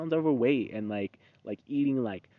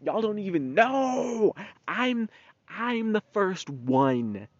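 proud drawn-out boast; 8 semitones higher, much slower than usual. Real, not acted.

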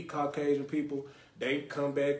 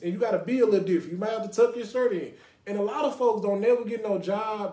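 ordinary delivery

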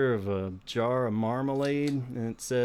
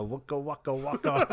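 Fozzie Bear voice. Retold or imitated. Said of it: "like, fucking Fozzie Bear".

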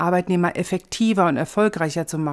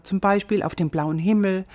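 ordinary talk, nothing marked

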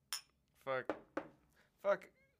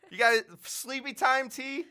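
incredulous; 11 semitones higher, 13 dB louder, slower than usual. Real, not acted. Incredulous laughing question.

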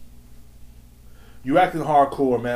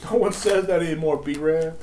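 toned-down scolding, slightly raised pitch; amused rebuke; 3 semitones higher than usual. Pretend. Podcaster repeating his jab after laughing, toned-down amused rebuke. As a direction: repeat the put-down without shouting.

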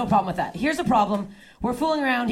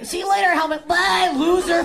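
mocking call; 7 semitones higher, 3 dB louder than usual. Jeers called at departing rider.

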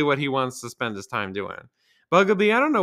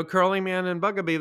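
usual speaking voice

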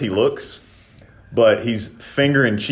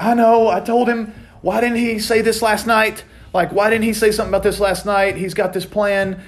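higher put-on voice of an exasperated mother; a mildly exaggerated frustrated mom; imitating mom; 12 semitones higher than usual. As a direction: play his mom, fed up and venting. Mom complaining about brother.

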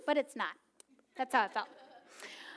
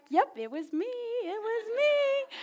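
put-on toddler owning up; 10 semitones higher, 3 dB louder, slower than usual.

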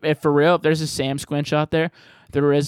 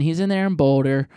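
usual speaking voice

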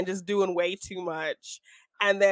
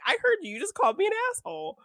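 much higher, indignant; offended guy; 14 semitones higher, faster than usual. Retold or imitated. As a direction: play him as outraged and disbelieving. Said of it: "he's like".

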